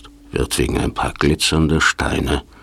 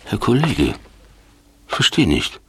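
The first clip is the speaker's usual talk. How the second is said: muffled voice; 7 semitones higher than usual. Acted. Freeman speaks from inside the sarcophagus.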